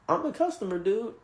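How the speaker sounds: very high-pitched, affronted disbelief; incredulous protest. Retold or imitated. Customer answering a rude waiter, incredulous. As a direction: protest as if you can't believe the nerve.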